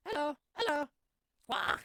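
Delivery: high squawky parrot greeting. Acted. Parrot greeting the visitors, high squawking parrot voice. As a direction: chirp it out like a talking parrot, then squawk. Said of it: "Hey, it's Polly".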